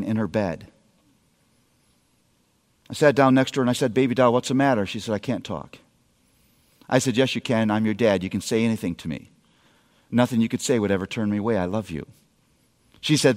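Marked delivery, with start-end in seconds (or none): 3.9-4.8 much softer, tender father's question; a dad softly asking his crying daughter what's wrong; gentle dad. Retold or imitated. 5.1-5.8 put-on much softer, lower, faint child's reply; a hint of a crying girl; tearful daughter. Crying daughter answers dad. 7.2-12.1 soft, low, fast comforting dad; a father soothing his daughter at night; tender reassurance. Retold or imitated.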